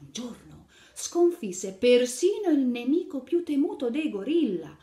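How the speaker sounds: put-on lively read-aloud to little children; animated picture-book narration; storytelling. A narrator's reading. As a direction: tell the fairy tale brightly to kids.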